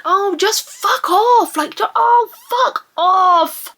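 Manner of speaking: high, drawn-out irritation; exasperated outburst. Acted. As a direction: moan it out, fed up. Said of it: "it's just like".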